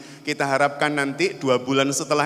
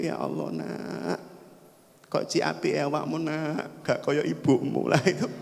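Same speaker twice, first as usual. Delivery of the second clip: mock tearful mother; 2 semitones lower, 7 dB quieter than usual. Mother moved by her kind child, acted tearful hush. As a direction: softly lament as if moved to tears. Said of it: "ibunya nangis".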